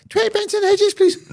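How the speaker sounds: put-on very high child's voice; wee boy Keenan. Boy asking the shopkeeper for cigarettes, squeaky and high. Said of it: "a wee boy Keenan comes in".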